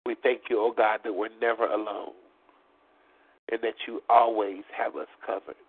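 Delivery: ordinary delivery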